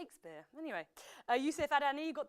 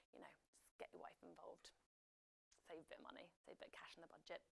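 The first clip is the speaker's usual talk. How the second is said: near-whispered, low joking aside; confidential aside; 7 semitones lower, 19 dB quieter, faster than usual. Real, not acted. Quiz host's sly aside on budgets, hushed. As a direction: drop to a hush, as if letting slip a tip.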